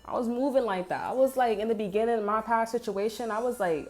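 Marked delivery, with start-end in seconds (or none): none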